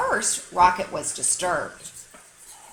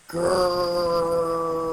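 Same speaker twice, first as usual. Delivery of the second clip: drawn-out rolled growl; 3 semitones lower than usual. Shown as a demonstration. Spelling the neighbour dog's growl, long growl. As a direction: growl like the dog. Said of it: "spelled Mister Barker's growl".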